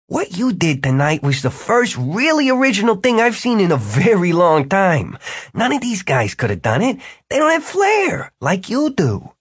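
high-pitched, emphatic praise from a wizard; high character voice, full of admiration; Jimmy, admiring. A narrator's reading. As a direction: gush over the performance, pitched well up. Jimmy praises Martin's display.

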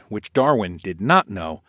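ordinary delivery

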